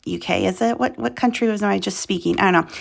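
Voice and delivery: normal talking voice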